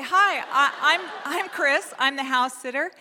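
high, loud, bright hello; overly cheerful greeting. Retold or imitated. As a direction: chirp a friendly introduction.

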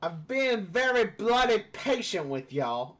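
high, slow, loud fake British; botched British accent. Host joining the accent parody, botched British. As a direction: overblown British accent going astray. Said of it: "I don't know what happened there".

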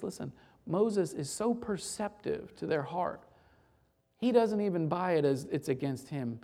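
ordinary delivery

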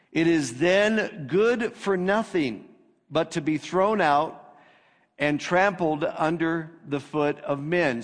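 scripture reading. Acted. Pastor reading Jesus' words to the congregation, solemn reading.

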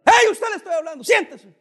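put-on the old pastor yelling at someone walking out; angry shout at a congregant. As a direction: bark them back into their seats. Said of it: "que hubiera dicho".

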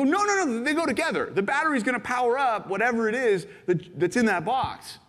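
pleading insistence. Retold or imitated. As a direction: urge them to open the box. Giver insisting gifts go together.

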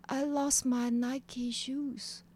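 acting out someone stunned and lost; dazed. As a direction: say it slowly, as if in a daze. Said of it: "like, in a daze".

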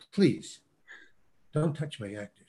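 imitated quiet plea, pause after the first word; restrained request. Director asks casting director to stop guiding actor, quiet restraint. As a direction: drop the volume and ask with restraint.

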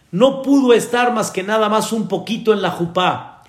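ordinary talk

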